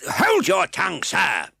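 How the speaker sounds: high, loud snapping rebuke; magistrate's rebuke. A narrator's reading. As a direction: shut him up from the bench. Fang voiced in reading.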